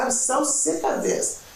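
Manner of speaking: slow, high exasperated wail; fed-up outburst. Retold or imitated. Wife retelling what she yelled at her husband, high and slow.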